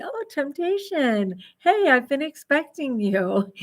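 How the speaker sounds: mock villain greeting. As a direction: greet temptation like a villain who saw it coming. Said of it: "I'm able to say".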